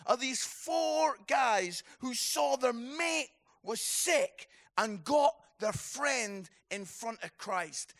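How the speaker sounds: normal talking voice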